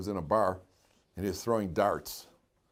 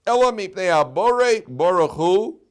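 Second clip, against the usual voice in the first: slow, higher, louder recitation; preaching; 7 semitones higher, 8 dB louder, slower than usual. Acted. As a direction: intone the text like a sermon. Preaching Rambam.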